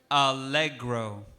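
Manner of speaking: allegro said slowly, syllable by syllable: a-LE-gro; careful allegro. Shown as a demonstration. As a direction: sound out allegro slowly for the kids. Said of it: "ready, listen carefully".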